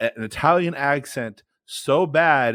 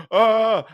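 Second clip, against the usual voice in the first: high, loud "ah" of alarm; loud panicked yell; 10 semitones higher, 6 dB louder than usual. Retold or imitated. Performer whose joke just bombed, mock panicked cry. Panic after bombing on stage.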